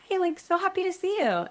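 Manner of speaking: very high, put-on perky voice; fake cheery greeting. Actress greets casting director hiding heartbreak, fake-cheery. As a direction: squeal hello as if nothing is wrong.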